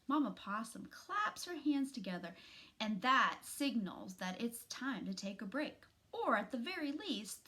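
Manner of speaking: ordinary delivery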